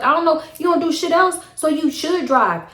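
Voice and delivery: high scornful imitation of him; high mocking boyfriend. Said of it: "he was like".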